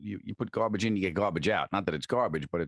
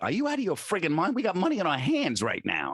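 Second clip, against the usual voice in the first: incredulous manager; 10 semitones higher than usual. Retold or imitated. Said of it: "my manager was like".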